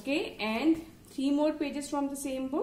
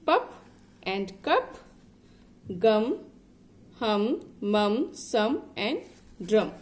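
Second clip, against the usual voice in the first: each word alone, louder and emphatic; read-along drill; 5 dB louder, slower than usual. Shown as a demonstration. Teacher reading a word list with a child, loud and emphatic. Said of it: "just read it with me".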